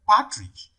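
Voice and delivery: partridge slowly, PAR pitched high; stress on first syllable. Shown as a demonstration. Teacher checks option D for stress, partridge stressed on PAR. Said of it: "falls on the first syllable too".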